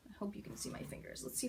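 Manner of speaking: hushed, breathy whisper. Real, not acted. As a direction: whisper so the neighbors sleep. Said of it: "turn myself down a little".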